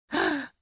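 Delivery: sharp, very high gasp; shocked gasp. Acted. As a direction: gasp in outrage. Mother shocked by privacy request.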